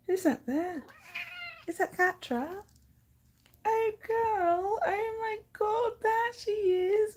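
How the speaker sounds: high, sweet pet-talk voice. Real, not acted. Cooing to her cat.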